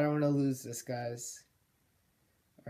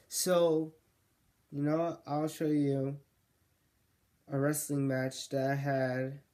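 normal talking voice

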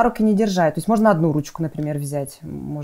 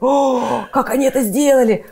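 put-on amazed child; 7 semitones higher, 4 dB louder than usual. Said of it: "чтобы они сделали так".

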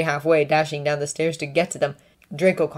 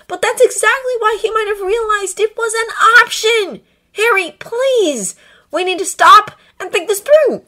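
high, loud, slowing on please; desperate pleading; 8 dB louder than usual. A narrator's reading. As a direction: beg him to stop and think. Draco pleading with Harry.

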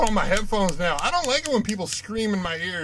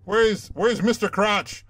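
normal talking voice

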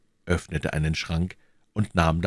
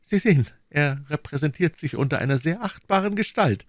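put-on young woman's wry reply; 10 semitones higher than usual.

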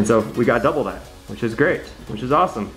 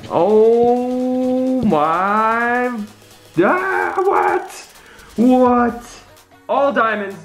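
shrieking jackpot winner; 7 semitones higher, much slower than usual. Real, not acted. Discovering all diamonds.

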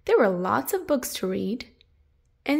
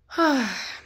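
deep breath; 2 semitones higher than usual. Acted. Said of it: "took a deep breath".

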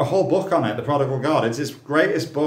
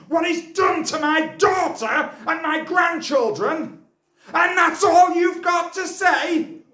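shouting much higher and louder, furious; outraged shout; 4 dB louder than usual. Acted. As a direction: roar it at him as the betrayed grandparent. Wronged parent confronts the teacher.

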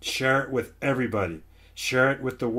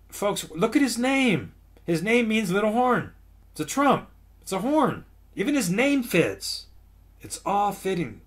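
emphatic exclaiming; 9 semitones higher than usual. Real, not acted. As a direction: cry it out high, driving the point home. Preacher exclaiming the clinching revelation.

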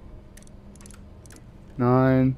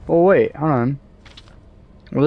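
ordinary talk

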